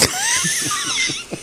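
high, breathy laugh. Real, not acted. Laughing that his friend's audio is muted.

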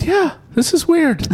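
high-pitched, amused agreement; squeaky mock reply. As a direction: answer in a squeaky, laughing voice. Own reply retold, very high.

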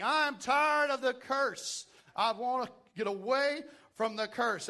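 rising preacher's fervor; preaching. Acted. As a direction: preach it with building force. Preaching at full force.

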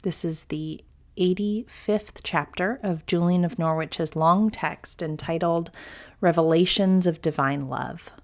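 ordinary talk

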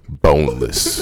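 loud, drawn out boneless. Pretend. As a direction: blurt it out loud. Prank caller repeats word loudly.

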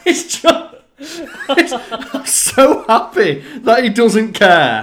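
high, loud, drawn out through laughter; laughing. Real, not acted. Telling co-host about the actor's cheeky tweet, laughing. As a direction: crack up while getting the words out.

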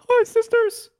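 hammed-up shrieky hello; very high, loud impersonated greeting; mock high-pitched greeting. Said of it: "Shit will open up saying".

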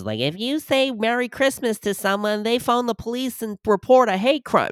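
a high, mocking impression of the Christmas complainers; mocking whiny voice. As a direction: sneer it in a high nasal parody. Mocking the war-on-Christmas crowd.

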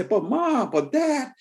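put-on high whining kid voice; high child pleading to parents; whiny child. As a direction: beg like a nagging child. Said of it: "they say".

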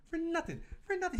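very high-pitched incredulous repeat; exasperated squeal. Real, not acted. Man ranting about a costly recall, high exasperation.

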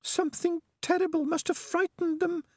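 very high-pitched, worried rat; Peaches the rat. A narrator's reading. Rat guessing at the danger ahead, high and worried. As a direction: wonder anxiously in a tiny high voice.

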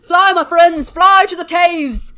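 hammed-up heroic shout; Maligent's rallying cry. Maligent warning villagers to flee, hammed-up heroic shout. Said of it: "it's Maligent".

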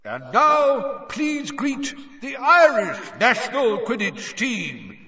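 high, loud booming announcer voice; announcer yell. A narrator's reading. Bagman presenting the Irish team, booming. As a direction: call to the whole stadium. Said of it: "yelled Bagman".

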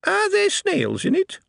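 high, cheeky goblin voice; a brash little goblin charming the host. Acted. Goblin asks.

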